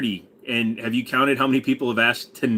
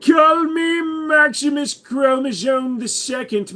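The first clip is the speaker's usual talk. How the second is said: high, slow, shouted grand announcement; hammed-up aristocrat shout; pompous royal voice; 12 semitones higher, 4 dB louder, slower than usual. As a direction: proclaim it like an inbred noble. Put-on royal character voice.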